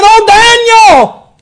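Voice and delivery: high, loud anguished call of the name; lamentable cry. Retold or imitated. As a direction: cry out the name like a worried king. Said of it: "in a lamentable voice".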